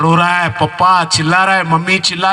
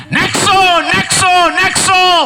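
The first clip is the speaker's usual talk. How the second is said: angry calling; 12 semitones higher, slower than usual. Acted. Commander shouting at the believer, high furious call. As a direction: yell his name in fury.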